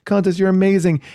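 high, thrilled squeal of a contestant who thinks they won; mock overjoyed contestant. Contestant hearing she won, high overjoyed gushing. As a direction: gush like you just won the whole contest. Winning contestant reacts, retold.

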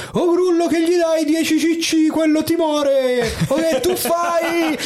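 high-pitched Florentine outburst, vowels stretched; mock Florentine. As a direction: heckle like an outraged Tuscan, very high. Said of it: "Poi tutti fiorentini, tutti toscanacci".

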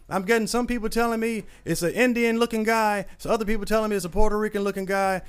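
high, put-on boss laying down the law; angry boss. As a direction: lecture him like he's caught. Boss firing him on phone.

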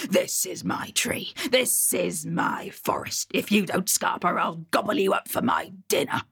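a troll hissing a warning from the branches; whispering troll. Acted. Troll threatens Ash Lad.

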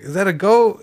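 a hint of a confused old-timer; puzzled old man. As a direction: play the half-blind old man peering close. Half-blind old man squints at carcass.

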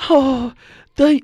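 accidental porn-actress impression. Botched impression of a friend, slipping into porn voice. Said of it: "that's a porn voice".

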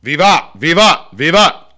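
loud ceremonial cheer, three times; shouted Vivat. Shown as a demonstration. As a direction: shout it out like a toast. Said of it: "I will toast him as well".

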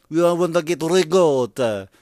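hammed-up north Italian accent, slow and high; mock the fake Italian accent, drawn out. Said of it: "develop this fucking accent like you're from the mountains".

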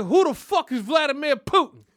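loud, high outraged shout; husband yelling at his wife about Putin; grandpa shouting. Retold or imitated. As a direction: yell the question, furious. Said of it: "He said".

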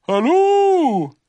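loud, high drawn-out call; strange animal. A narrator's reading. Strange animal answers Pooh outside, loud whooped greeting. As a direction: whoop it back as an unknown creature. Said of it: "said the strange animal".

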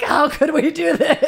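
loud, high outcry; mock dismay. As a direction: cry it out in exaggerated horror. Acting out their own dismay.

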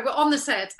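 usual speaking voice

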